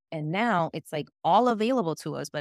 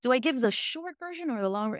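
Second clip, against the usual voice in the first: mock deliberation; 7 semitones higher than usual. Voicing her own musing at question.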